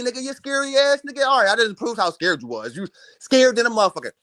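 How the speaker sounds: ordinary delivery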